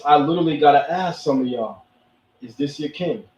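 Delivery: normal talking voice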